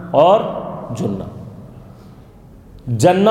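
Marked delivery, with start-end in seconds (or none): 1.0-1.2 "جنہ" said as "junna"; short u opening "جنہ"; pesh on jim. Shown as a demonstration. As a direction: say "جنہ" as "JUN-na". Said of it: "تینوں کے ساتھ".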